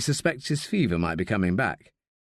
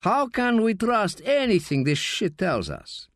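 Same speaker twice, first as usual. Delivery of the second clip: scornful; 10 semitones higher than usual. A narrator's reading. Raoul scorning the American.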